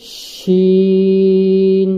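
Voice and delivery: "শিন" with SH from mid-tongue, vowel held very long; long shin. Shown as a demonstration. Teacher introducing the letter shin, long-drawn SH. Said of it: "জিহ্বার মধ্যখান".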